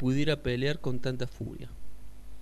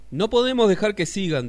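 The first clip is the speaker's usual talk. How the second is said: urgente; 7 semitones higher, 10 dB louder than usual. Acted. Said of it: "dijo el sapo".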